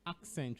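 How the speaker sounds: "Accent" said with a K, AK-sent; K sound. Shown as a demonstration. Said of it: "with a K sound there".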